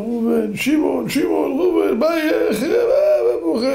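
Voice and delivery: high-pitched wailing to a lost friend; mock tearful cry. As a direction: cry out the names in an acted, rising wail. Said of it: "התחילו בוכים זה על זה". (קריאה בוכייה ומוגזמת, בקול גבוה)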